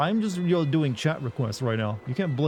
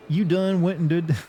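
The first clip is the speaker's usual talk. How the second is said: louder, higher southern twang; southern accent; 5 semitones higher, 4 dB louder than usual. Shown as a demonstration. Streamer showing chat a southern word, southern accent. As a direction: play up the southern "done". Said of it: "southern word".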